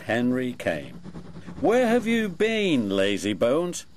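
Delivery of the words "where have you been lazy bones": cross. A narrator's reading. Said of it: "asked Thomas crossly".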